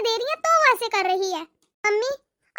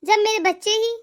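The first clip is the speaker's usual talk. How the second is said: tender comfort; 5 semitones lower than usual. Acted. Mother comforts her daughter-in-law. (बहू को दिलासा देती माँ, बहुत नीची, धीमी और कोमल आवाज़)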